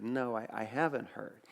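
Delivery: low, soft, hesitant reply; retold quiet, careful admission; hushed concern. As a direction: answer quietly, bracing for bad news. Soft reply to friend's bad news.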